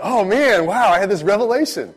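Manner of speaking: high, loud wow of sudden revelation; hammed-up awe; mock amazement. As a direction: act thrilled by your own bright idea. Mocking people claiming an idea as theirs.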